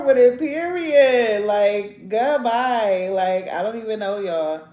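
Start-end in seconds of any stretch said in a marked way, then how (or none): none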